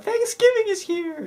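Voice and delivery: high, slow, gleeful announcement; hammed-up joy at a feast; mock festive cheer. Friends mocking the teacher's huge lunch, gleeful mock cheer.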